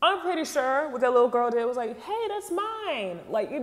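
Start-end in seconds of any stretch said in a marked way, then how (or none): 2.0-3.2 high, drawn-out mock complaint; raised-octave protest. Imitating a little girl protesting stolen food, raised octave. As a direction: voice jumps up an octave, stretched out. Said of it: "raising your voice a upper octave".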